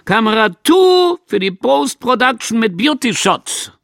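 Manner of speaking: high, loud set command; supervisor calling out. A narrator's reading. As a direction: call it loudly to the crew. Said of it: "Der Supervisor hat ... gerufen".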